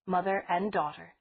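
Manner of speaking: usual speaking voice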